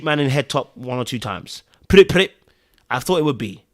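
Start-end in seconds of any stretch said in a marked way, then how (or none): 1.9-2.3 loud, high spoken gun noises; imitated gunshots. Shown as a demonstration. Viewer predicting Jake's revenge, imitated gunshot noise. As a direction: fire off two sharp mouth-made shots.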